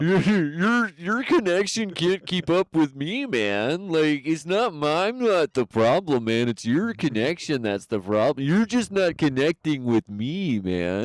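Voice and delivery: high, slow stoner drawl; laid-back stoner guy voice; Tommy Chong impression. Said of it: "that's my Tommy Chung impression".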